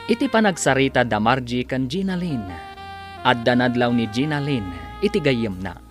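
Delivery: ordinary delivery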